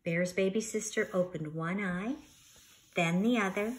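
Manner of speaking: lively, expressive storybook reading; reading to children. Acted. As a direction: read it like story time for a class. Teacher reads picture book aloud.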